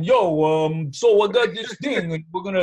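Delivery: imitating a Brooklyn movie-star accent; a light mock Brooklyn tough-guy accent. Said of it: "the movie star accent".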